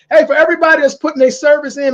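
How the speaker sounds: normal talking voice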